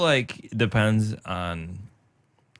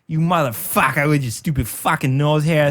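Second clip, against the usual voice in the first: lightly put-on Boston tough guy, a bit louder; Boston accent; 6 semitones higher, 4 dB louder than usual. Boston nose hair insulting the other nostril, Boston accent. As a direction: snarl it like an angry Boston guy. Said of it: "your super Boston guys".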